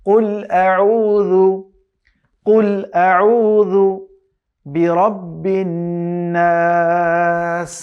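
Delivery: very slow chanted verse, long held নাস; Quran recitation. Shown as a demonstration. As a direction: recite slowly, stretching the last word. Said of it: "খুবই নরম করে". (দীর্ঘ টেনে সুরেলা তিলাওয়াত)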